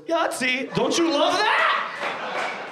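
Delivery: put-on slow, high, sing-song fry girl; cheery fry girl. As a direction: croon it like a proud fry cook. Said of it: "just goes".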